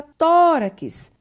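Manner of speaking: first syllable of "tórax" loud, high and long; stress on TÓ. Shown as a demonstration. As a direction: lean hard on the TÓ in "tórax". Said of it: "pronunciada com mais força". (sílaba TÓ de "tórax" com mais força)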